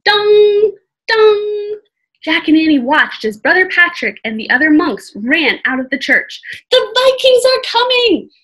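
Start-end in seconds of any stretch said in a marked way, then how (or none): 0.0-1.8 loud, high ringing "dong"; imitated bell toll. A narrator's reading. Imitates the yanked alarm bell. 6.7-8.3 high, loud alarm cry; shouting. A narrator's reading. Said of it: "Jack shouted".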